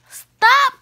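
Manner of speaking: high, loud shout; crying out. A narrator's reading. Splat to classmates chasing his mouse, crying out. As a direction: yell to halt the chase. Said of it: "cried Splat".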